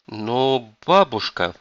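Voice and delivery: slow, drawn-out protest; tentative objection. A narrator's reading. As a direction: begin to object, dragging the words. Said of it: "начал было Джон".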